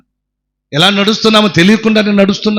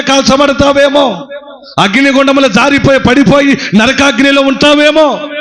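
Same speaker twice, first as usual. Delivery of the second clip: high, loud fervent exhortation; preaching at full force; 8 semitones higher, 3 dB louder than usual. Acted. Preacher exhorts the congregation to pray and read, fervent and loud. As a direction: urge the flock on with fire.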